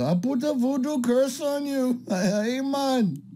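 bad Jamaican accent. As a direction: ham up a weak Jamaican accent for the joke. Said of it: "my Jamaican accent is not so good".

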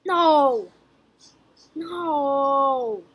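loud cries of no; real frustration; despairing shouts. Real, not acted. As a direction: yell no as the shot misses. Missed green shot.

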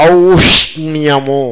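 ఔష్ణ్యము very slow, sh-n-y all sounded; long opening au in ఔష్ణ్యము, then each consonant distinct; careful cluster. Shown as a demonstration. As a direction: draw out ఔష్ణ్యము as au-sh-nya-mu. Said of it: "తిప్పారా అలాగా?".

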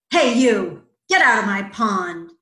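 loud, scolding frog; bossy bully. A narrator's reading. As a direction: order the intruder off forcefully. Bully frog chases off dragonfly.

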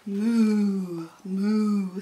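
long, drawn-out moo; sustained cow call at an easy pitch. A narrator's reading. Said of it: "said the cow".

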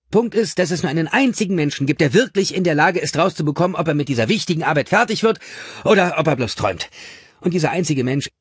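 high, fast, pressing monologue; insistent boss dictating. A narrator's reading. Gordon ranting onto the answering machine, insistent and rapid. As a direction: hammer the point home at speed. Gordon's recorded rant continues.

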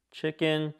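usual speaking voice

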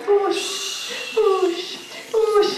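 high whooshing wind sound; playful wind noise for the kids; breeze whoosh. Shown as a demonstration. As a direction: blow it like a cool breeze. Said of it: "Can you make a cool breeze".

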